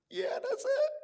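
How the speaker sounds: put-on very high, crying-laughing child voice; tearful little daughter. Daughter answering her dad through tears, very high and crying. Daughter confirming the tiny spider.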